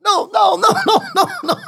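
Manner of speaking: high, laughing mock no from Prabhupada; laughing high-pitched no, no, no. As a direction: squeal no through a laugh. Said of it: "And Prabhupada's like".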